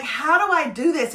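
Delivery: usual speaking voice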